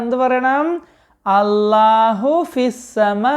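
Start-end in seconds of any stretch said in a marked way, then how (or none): 1.3-3.4 put-on slow, drawn-out answer for children to repeat; slow model answer. Shown as a demonstration. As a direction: spell it out slowly so the kids can echo it. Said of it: "ഉറക്കെ പറയണം".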